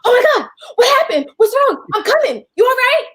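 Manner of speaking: high, loud, fast panicked rush; mock a frantic friend freaking out; a friend panicking high and fast on the phone. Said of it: "as opposed to her being like".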